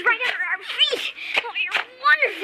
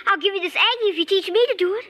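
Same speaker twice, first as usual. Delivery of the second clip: usual speaking voice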